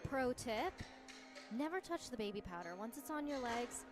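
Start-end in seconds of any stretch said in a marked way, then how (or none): none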